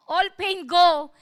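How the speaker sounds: loud, slow, drawn-out order; emphatic retold faith declaration; imitated prayer command. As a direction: command the pain to leave. Acts out patient commanding pain away.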